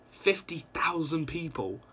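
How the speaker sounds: breathless whispered awe; hushed amazement; awed whisper. Real, not acted. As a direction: whisper it in disbelief. Awed at city's size.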